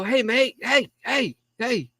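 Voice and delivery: high, teasing wake-up calls; waking someone up. Retold or imitated. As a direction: nudge a sleeping friend. Canadians waking him.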